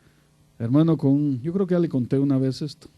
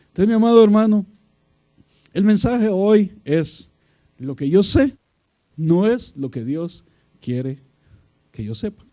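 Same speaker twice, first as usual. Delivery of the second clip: preaching emphasis; 5 semitones higher, 8 dB louder than usual. Acted. Announcing the sermon's message.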